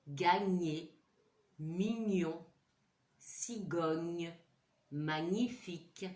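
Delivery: each gn word said slowly and clearly, the "gn" in "baignoire" and "mignon" drawn out; word list demo. Shown as a demonstration. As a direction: spell out every gn sound with care. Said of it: "le son que nous allons voir".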